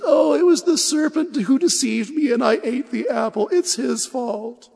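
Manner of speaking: high, hammed-up wailing excuse; crying. Eve blaming the serpent to God, in hammed-up tears. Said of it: "She starts to cry".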